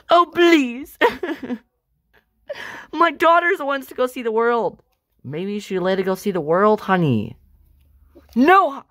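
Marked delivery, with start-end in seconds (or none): none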